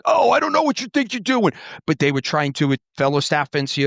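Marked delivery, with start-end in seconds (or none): 0.1-1.5 lampoon a drill-field bully talking down; mock drill instructor. Mocking how bully drill instructors talk to Marines, higher sneer. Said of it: "they would just be like".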